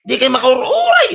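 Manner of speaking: angry high-pitched retort; indignant shout. Acted. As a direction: yell it back, offended. Debtor snaps back at creditor.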